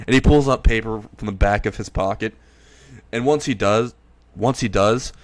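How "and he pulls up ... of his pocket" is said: ordinary delivery, nothing marked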